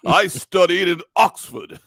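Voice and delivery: high, loud, slow mock-grand declaration; boastful British actor. Actor assuring casting he can do accents, mock-grand boast. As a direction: ham up an actor proudly declaring credentials. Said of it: "they were like".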